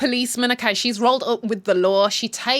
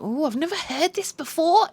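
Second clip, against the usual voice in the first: mock amazement; 7 semitones higher than usual. Acts her reaction to the music.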